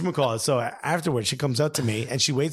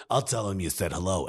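low New York mother's sign-off; New York accent; 9 semitones lower, 4 dB quieter than usual. Retold or imitated. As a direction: imitate her, deep New York voice. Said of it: "She goes".

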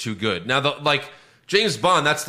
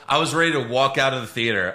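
ordinary talk, nothing marked

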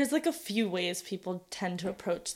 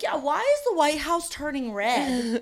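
puzzled viewer voice, slow and higher; confused viewer; 6 semitones higher than usual. Retold or imitated. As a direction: play a baffled reader squinting at the cover. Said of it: "they'd be like".

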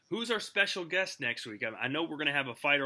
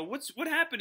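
retold surprise; 12 semitones higher than usual. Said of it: "and I go".

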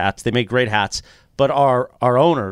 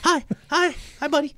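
high, gushing fan hellos; starstruck high greeting; 14 semitones higher than usual. Retold or imitated. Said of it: "Or no, eighteen".

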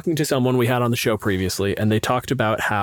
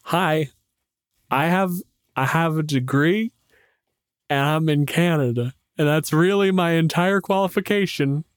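higher, self-deprecating shrug of a voice; lightly hammed-up humble intro; mock sheepish intro; 4 semitones higher than usual. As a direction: play it like you don't belong here. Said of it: "And I was like".

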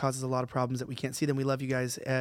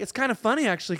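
high, happy retelling of bad news; 7 semitones higher than usual. Imitating cheerful Aussies describing trouble, high happy intonation. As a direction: lift it high and light, as if amused. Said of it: "It sounds so happy".

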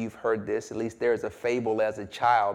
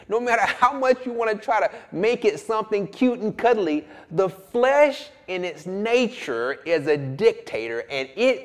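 building sermon, rising higher and louder; preaching at full force; 7 semitones higher, 5 dB louder than usual. Acted. Pastor hammering the point home to the congregation. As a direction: drive the repetitions home like a preacher.

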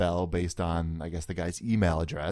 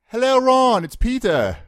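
high, loud mock British accent; Peter Moore impression; 7 dB louder than usual. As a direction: put on a cheeky British accent for a prank call. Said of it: "pretending I was Peter Moore".

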